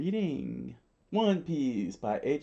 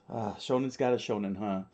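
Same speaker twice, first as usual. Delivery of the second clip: ordinary delivery, nothing marked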